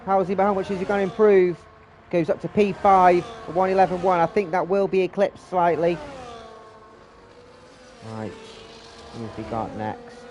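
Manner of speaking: brisk live play-by-play call; race commentary. Real, not acted. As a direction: call the qualifying laps as they happen.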